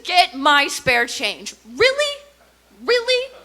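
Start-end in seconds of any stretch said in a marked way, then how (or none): 1.7-3.3 high, loud outraged "really?"; indignant disbelief. Retold or imitated. Comedian offended by a costumed beggar, loud incredulous outrage.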